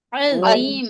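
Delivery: عظیم with a strong throaty ain at the start. Shown as a demonstration. (عظیم حلق کے عین کے ساتھ)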